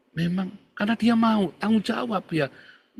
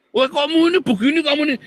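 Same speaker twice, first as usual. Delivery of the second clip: loud, much higher-pitched nagging; acted scolding; 10 semitones higher, 6 dB louder, faster than usual. Complainer rounds on the one who was sent, scolding. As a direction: play someone telling off the messenger.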